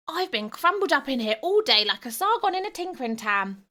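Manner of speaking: high, loud whining goblin; squeaky royal goblin grumbling; whiny goblin ghost. Acted. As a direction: complain like a cramped little ghost prince. Ghost prince complains from jar.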